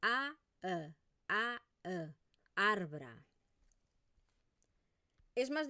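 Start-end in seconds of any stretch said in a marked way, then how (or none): 0.0-2.1 "A, E" with the two vowels set clearly apart; contrasting vowels. Shown as a demonstration. Teacher contrasting A and E for students. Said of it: "Esto es A". 2.5-3.2 "Arbra" with the final vowel as a schwa; neutral vowel. Shown as a demonstration. Teacher modelling the neutral vowel in "Arbra". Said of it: "No es una A".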